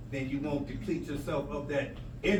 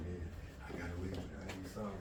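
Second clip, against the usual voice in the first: much softer, lower, unrepentant; smug low mutter; 10 semitones lower, 13 dB quieter, faster than usual. Acted. Wrongdoer gloating to himself, low and soft. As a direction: murmur it like a secret.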